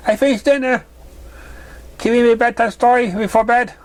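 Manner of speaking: a bright little filly voice, pitched well up; high filly voice. Acted. Curious filly questioning the stranger in a high voice. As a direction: play the curious young filly.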